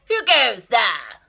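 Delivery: loud, high bullying shout; highway rat shouting. Acted. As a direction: bellow it like a greedy bandit rat. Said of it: "who cried out".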